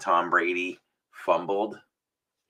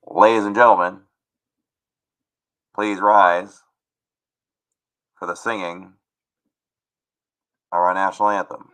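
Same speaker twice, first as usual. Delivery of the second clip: loud, spaced-out PA announcement; stadium announcer; 8 dB louder than usual. Shown as a demonstration. Host addressing the crowd before the anthem, stadium announcer voice. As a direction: announce it to a whole ballpark, pausing between phrases.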